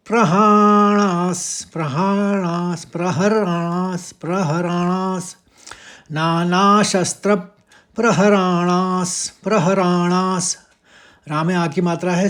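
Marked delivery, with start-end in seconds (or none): 0.1-10.6 प्रहरणास repeated slowly, each syllable clear; pronunciation drill. Shown as a demonstration. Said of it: "त्र के संग प का भी निकाल देंगे".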